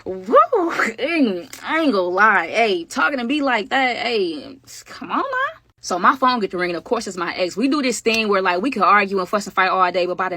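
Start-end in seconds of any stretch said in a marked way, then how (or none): 0.1-5.6 a squealed woo, then a high come on now; giddy and flattered, voice jumping up; flustered excitement. Real, not acted. Reacting to her flirting.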